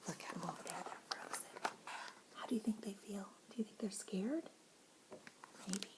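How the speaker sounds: hushed questions; whisper. Real, not acted. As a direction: whisper like the animals might hear.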